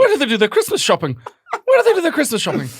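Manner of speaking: high, loud baffled posturing; mock snobbish dismay. Joining the tease about poor people, high mock dismay. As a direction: pitch it up in fake concern. Joins the mock snob bit.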